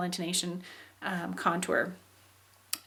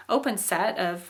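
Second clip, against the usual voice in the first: ordinary delivery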